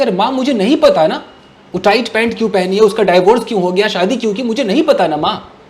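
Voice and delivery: loud, exasperated at mother; snapping back loudly at mother. Retold or imitated. Son raising his voice at his mother, exasperated. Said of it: "my voice loud".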